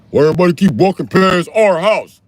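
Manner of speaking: loud, high-pitched mock complaint; indignant yelling. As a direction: yell it like an irate neighbour. Acting out a neighbour's complaint.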